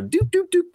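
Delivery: high-pitched doop sounds; imitated button beeps. Host mocks producer pressing all his buttons, high beeping noises.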